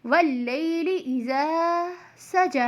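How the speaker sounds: slow "वल्लैलि इज़ा सजा", final आ held in "इज़ा" and "सजा"; lengthened recitation. Shown as a demonstration. Teacher corrects the short reading, long vowels on "इज़ा" and "सजा". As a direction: stretch the endings of "इज़ा" and "सजा". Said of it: "उस word को थोड़ा सा खींचते हैं".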